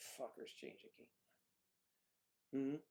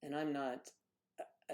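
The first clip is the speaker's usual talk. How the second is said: ordinary delivery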